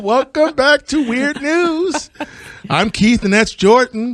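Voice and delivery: high, loud show opening; excited host intro. Real, not acted. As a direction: open the show with big energy.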